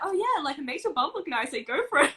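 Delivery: high mock-enthusiastic mum; mum approving. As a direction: play the cheerful supportive mother. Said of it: "she's like".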